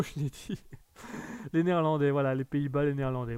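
ordinary talk, nothing marked

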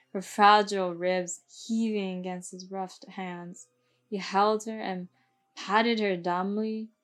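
plain reading voice

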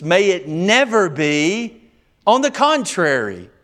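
"May it never be" high and drawn out, NEVER stretched; emphatic denial. Acted. Preacher reading Paul's answer on law and sin, emphatic. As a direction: reject it firmly, leaning slowly on "never". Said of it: "Notice the emphasis".

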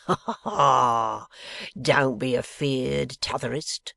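slow, loud mock laugh into rustic drawl; slow rustic taunt with a hollow ha-ha-ha; jeering lock-keeper. A narrator's reading. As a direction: jeer slowly, like a rough riverman. Riderhood jeers at Bradley.

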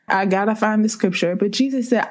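ordinary talk, nothing marked

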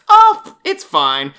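loud, drawn-out mock dismissal; mock nonchalance. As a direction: wave it away with exaggerated ease. Mimics everybody shrugging off the danger.

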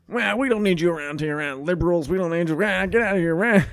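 cartoonish angry shouting, imitated; mock yelling. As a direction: holler like a crank from a passing car. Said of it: "and then yelled out the window".

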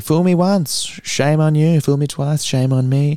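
imitating a comic's silly delivery. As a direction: quote the saying in a high, goofy voice. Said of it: "he's got a silly voice too".

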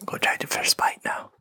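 soft unvoiced ASMR whisper. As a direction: whisper it close to the mic. ASMR bite introduction.

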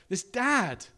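ordinary talk, nothing marked

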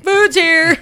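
high, loud call-out; mock delivery shout. Courier barging into a house to announce food, loud shouted call.

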